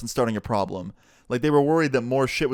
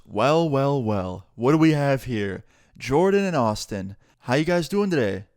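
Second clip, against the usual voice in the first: sarcastic taunt. Retold or imitated. School bully taunting two kids at their table, sarcastic. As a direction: sneer it like a smug bully. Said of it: "he was being sarcastic".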